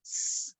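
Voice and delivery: whispered hissing "स" with no voice; isolated s phoneme; s sound. Shown as a demonstration. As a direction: breathe out a bare "स" hiss. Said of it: "एस को क्या बोलते हैं?".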